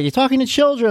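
mock the girl's outraged gasp; quoting the girl from the meme, indignant. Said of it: "that girl's like".

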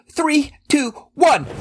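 building countdown. Acted. Counting down before charging in.

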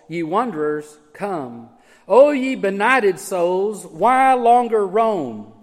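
slow, solemn reading of hymn lines; hymn recitation. Acted. Preacher to congregation, reciting the old hymn slowly. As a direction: intone the hymn verse slowly, with gravity.

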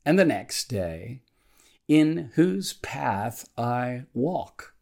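measured devotional reading with deliberate pauses between phrases; slow prayer reading. Real, not acted. Pastor reading a morning prayer to devotional listeners, slowly and deliberately. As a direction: read the prayer slowly, setting each phrase apart. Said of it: "I'm gonna read it slowly".

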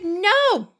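high-pitched, incredulous quoted refusal; imitated refusal. Man refusing teens a boat ride, imitated high-pitched protest.

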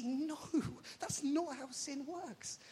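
slow, stressed no; emphatic with a laugh. Retold or imitated. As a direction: insist firmly, slowing on the last words. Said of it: "Jesus says emphatically".

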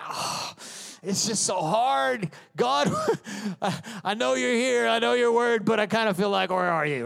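high, hammed-up whining prayer; mock anguish. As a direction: wail to God as if struggling, half in jest. Voicing believers struggling in hardship.

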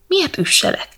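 high-pitched, pleading protest; reluctant protest. A narrator's reading. Prince begging the cat to spare him the task, reluctant. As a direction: balk at it, pitch jumping well up. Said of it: "szabódott János szegény".

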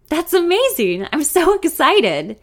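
high, loud burst of delight; excited. A narrator's reading. Songwriter hearing her song will be cut, excited. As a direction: burst out, stretching the words. Songwriter hears great news.

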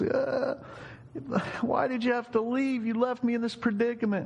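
very high, tearful pretend wail; mock wailing. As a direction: keep up the hammed-up sobbing lament. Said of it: "but the wailing just continued".

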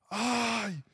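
imitación aguda y alargada de un coach exasperado; gritos del coach. Retold or imitated. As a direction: imita al coach, estirando el reproche en tono alto. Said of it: "me empieza a gritar". (regaño a gritos, imitado)

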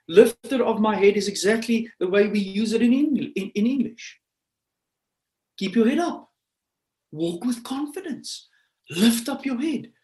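high, loud preaching in punchy phrases; exhorting preacher. Acted. Preacher tells people to walk with confidence, forceful exhortation.

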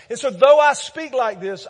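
high and loud, punching though; loud proclaiming. Real, not acted. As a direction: declare it over the room. Echoing the quote loudly.

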